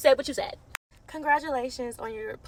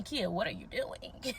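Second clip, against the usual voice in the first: quoted friends; 6 semitones lower, 10 dB quieter, slower than usual. Retold or imitated. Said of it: "They always told me".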